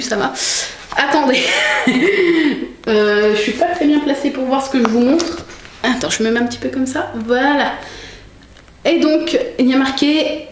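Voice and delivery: usual speaking voice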